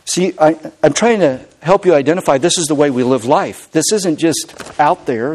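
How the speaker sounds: ordinary talk, nothing marked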